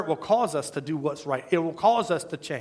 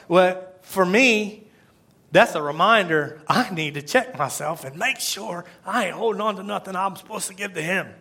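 ordinary delivery

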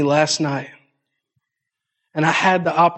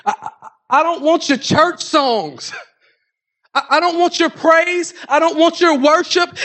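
God's rebuke; 9 semitones higher than usual. Acted. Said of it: "God said".

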